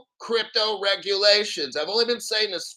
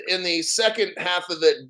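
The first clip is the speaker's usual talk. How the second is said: normal talking voice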